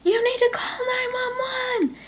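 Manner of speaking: high-pitched, held-back scream without volume; mock scream. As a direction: act out screaming for help from afar, voice way up high. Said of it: "And I just scream".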